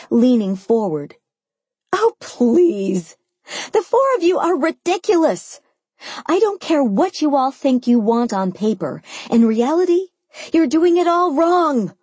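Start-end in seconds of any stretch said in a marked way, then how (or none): 1.9-11.9 high, exasperated rant; pitched-up, indignant outburst; emphatic tirade. A narrator's reading. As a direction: scold them all in exasperation. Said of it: "I answered, smacking the top of the water for emphasis".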